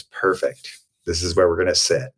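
a hint of an unbothered therapist; calm therapist. Therapist voiced in story.

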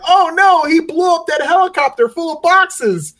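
high loud dismayed cry, laughing; hammed-up horrified exclamation, high; mock dismay. Parody quote, dismayed.

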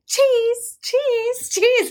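high squealed photo "cheese"; hammed-up, high-pitched kid excitement; over-the-top excited. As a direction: mimic an over-excited little girl posing. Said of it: "like, over the top, so excited".

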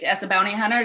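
ordinary talk, nothing marked

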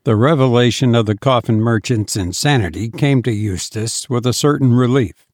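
ordinary delivery, nothing marked

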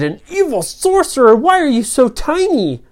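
put-on high, loud, incredulous kids; astonished question. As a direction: blurt it out in amazement. Children astonished by tiny sorcerer.

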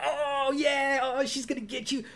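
high-pitched gleeful playground teasing; imitating a tattling sibling, high and sing-song; mock sibling taunt. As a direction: taunt like a kid hiding behind mom. Sibling taunting from behind mom.

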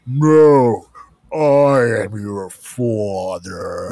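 slow, deep, heavy Vader line; Darth Vader voice. Shown as a demonstration. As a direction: drag it out low like Vader. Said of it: "you gotta have more bass in it".

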